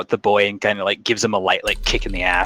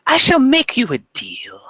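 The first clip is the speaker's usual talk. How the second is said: much higher, thin witch voice; creepy crone; 14 semitones higher, 3 dB louder than usual. Acted. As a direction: lure them in like a sly witch. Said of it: "she says".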